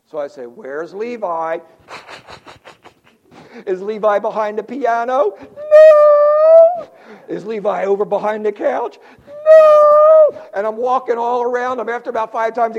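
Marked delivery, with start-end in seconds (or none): 0.4-10.5 mock search with shrill high No's; hammed-up grandpa searching; playful searching. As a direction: tease the toddler by pretending to look. Playing hide and seek with toddler.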